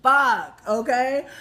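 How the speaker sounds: normal talking voice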